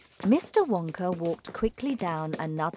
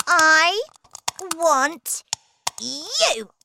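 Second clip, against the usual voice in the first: high, loud, word by word; emphatic bratty little-girl voice; demanding spoilt child; 11 semitones higher, 8 dB louder, much slower than usual. Acted. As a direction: separate each word as a greedy demand. Veruca picks out her squirrel.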